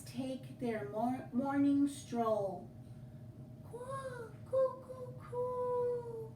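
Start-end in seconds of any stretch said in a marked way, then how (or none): 3.7-6.3 lilting dove coos; cooing dove call. Acted. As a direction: coo it gently, way up high. Reading dove calls aloud.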